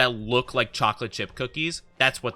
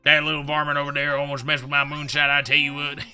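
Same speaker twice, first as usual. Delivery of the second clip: put-on country bumpkin accent. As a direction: play a moonshine-drinking Southern yokel. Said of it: "That's kinda the vibe they give".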